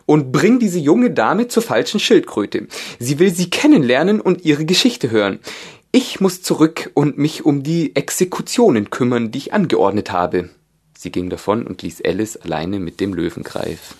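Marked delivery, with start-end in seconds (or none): 0.1-10.5 high, loud queen voice; imperious royal command; evil queen. A narrator's reading. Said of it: "sagte die Königin". (böse Königin, hoch und gebieterisch)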